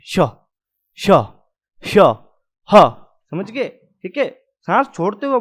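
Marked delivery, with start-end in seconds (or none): 0.0-2.9 श श श ह hissed out on the breath; teacher sounding out the sibilants; breathy fricative demo. Shown as a demonstration. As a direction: push each sound out with the breath. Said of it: "सांस छोड़ते हुए बोलते हैं".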